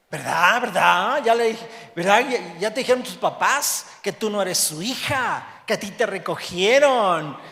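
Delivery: loud, sing-song jeering little bully; mocking taunt. Mean schoolgirl taunting the adopted girl, loud and mocking. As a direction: taunt her, rubbing it in.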